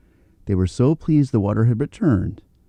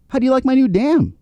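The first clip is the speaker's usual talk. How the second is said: beaver voice; 13 semitones higher, faster than usual. Acted. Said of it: "asked Bart".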